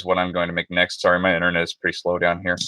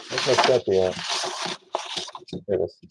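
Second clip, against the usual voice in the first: muffled behind a respirator mask; through a respirator; 5 dB quieter than usual. Real, not acted. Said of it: "back on with the respirator".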